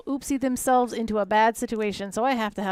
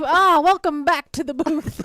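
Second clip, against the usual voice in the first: caster voice; 2 semitones higher, 5 dB louder, slower than usual. Retold or imitated. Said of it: "you're like".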